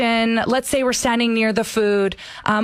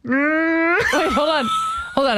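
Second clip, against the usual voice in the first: speaking on inhale; 7 semitones higher, slower than usual. Acted. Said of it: "speaking while breathing in through their nose".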